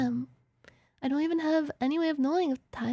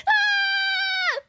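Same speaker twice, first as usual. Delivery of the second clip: high-pitched drawn-out scream; sudden wailing "ah"; 4 dB louder than usual. Pretend. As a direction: let out a long high cry. Frustrated scream in game.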